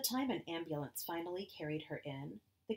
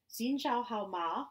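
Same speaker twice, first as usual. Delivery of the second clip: Chinese; 6 semitones higher, 5 dB louder, slower than usual. Acted. Mother speaks Chinese, slow.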